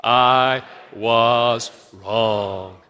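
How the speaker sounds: very slow, drawn-out "I was wrong"; the congregation drags out "I was wrong" together, slowly; slow group confession. Shown as a demonstration. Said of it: "Let's just do a dry run".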